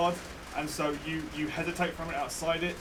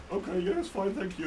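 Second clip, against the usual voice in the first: put-on old man voice; 3 semitones higher than usual. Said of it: "the old man snatches it out of the air and goes".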